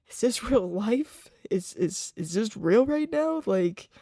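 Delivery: lightly acting out a spaced-out first high; stoned and tripping. Teen on his first high asks his dad if this is real, dazed. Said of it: "Like, I was tripping".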